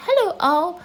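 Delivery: high, loud squeak; squeaky little Roo. A narrator's reading. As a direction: squeak it out like an excited little joey. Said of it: "Roo greeting at party".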